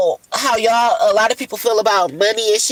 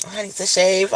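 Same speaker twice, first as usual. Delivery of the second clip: ordinary talk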